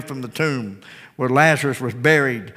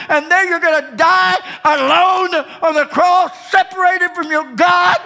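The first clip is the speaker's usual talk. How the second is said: high sermon crescendo, building to a shout; impassioned preaching; 10 semitones higher, 3 dB louder than usual. Real, not acted. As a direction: drive each phrase harder, climbing to a full cry.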